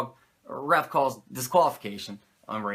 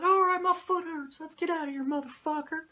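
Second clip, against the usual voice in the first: much higher squeaky imitation of a manager; Cornette's high-pitched voice. Cornette plans the beatdown in a high-pitched mock voice. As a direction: ramble the plan in a strained falsetto. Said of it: "Cornette's like".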